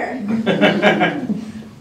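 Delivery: loud amused granny laugh; old woman chuckle. Acted. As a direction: chuckle warmly at the bear. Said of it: "Baba Brana chuckled".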